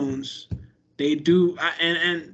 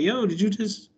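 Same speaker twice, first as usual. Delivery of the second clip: affronted surprise; 7 semitones higher than usual. Retold or imitated. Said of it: "you be like".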